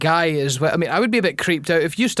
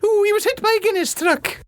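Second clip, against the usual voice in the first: much higher falsetto line; mock granny voice. Joining the Mrs Doubtfire bit, high falsetto. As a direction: chime in as the old lady.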